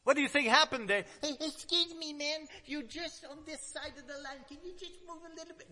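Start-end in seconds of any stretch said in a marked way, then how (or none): 1.2-5.7 soft, high, timid little plea; mock meek asking to move aside; timid polite request. Preacher mocks a meek Jesus asking politely. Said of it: "No, no, no, that's not how".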